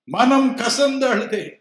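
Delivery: மனம் கசந்து அழுதே cried out high and loud in grief; wailing repeat. Shown as a demonstration. Preacher repeating மனம் கசந்து அழுதே as a high, loud wail.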